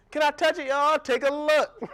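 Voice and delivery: high, loud put-on voices; mock friend and owner. Friend asking to touch the purse, high and pushy.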